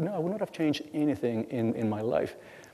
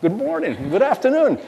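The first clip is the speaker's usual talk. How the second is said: put-on high, louder sing-song kid hellos; kids' cheery greeting; 12 semitones higher, 5 dB louder than usual. His kids greeting everyone on walks, high cheery sing-song. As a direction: chirp it like a child greeting neighbors. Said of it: "they go".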